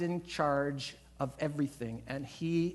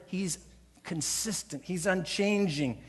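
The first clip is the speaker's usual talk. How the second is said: ordinary talk, nothing marked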